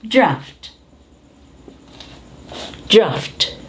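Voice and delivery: DRAFT as one syllable with an F sound before T; draft pronunciation. Shown as a demonstration. Teacher models the word for the class, DRAFT with F. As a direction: say "draft" with AU as a short A. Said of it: "So you pronounce this".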